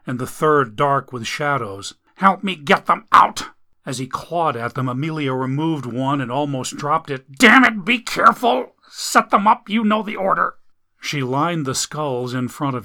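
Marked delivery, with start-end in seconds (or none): 2.2-3.5 higher, louder urgent order; wounded man ordering her; urgent command. Acted. Urgent demand. 7.4-10.6 high, loud irritated scolding; angry man scolding his girlfriend; angry snap. Acted. Jack snaps at her.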